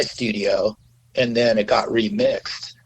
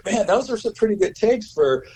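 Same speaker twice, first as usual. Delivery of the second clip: imitating the speaker quoted; 9 semitones higher than usual. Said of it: "he goes".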